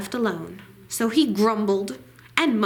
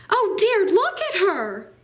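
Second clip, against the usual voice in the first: higher, louder startled grandmother; a granny caught off guard, half alarmed; gasping granny; 10 semitones higher, 4 dB louder than usual. Acted. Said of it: "Granny gasped".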